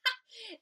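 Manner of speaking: short, high, loud laugh; storybook mouse's pealing laugh. A narrator's reading. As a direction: burst out in a quick acted laugh. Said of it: "The mouse laughed".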